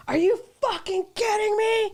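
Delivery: high, incredulous yell at a stranger; drunk guy yelling. Retold or imitated. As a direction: shriek it like a furious drunk gambler. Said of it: "he was like".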